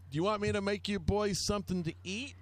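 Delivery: high, quick imitation of a Southern mom; southern lady voice. Friend's mom offering the drunk boys food, imitated southern lady voice. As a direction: put on a high Southern lady's voice. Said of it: "In that southern lady voice".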